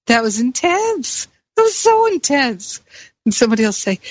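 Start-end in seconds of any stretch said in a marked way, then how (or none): 0.1-2.8 higher, gushing amazement; giddy relief. Retold or imitated. Coming out of the horror ride, giddy high gushing. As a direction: rave about the ride, pitch soaring. Retelling exhilaration after ride.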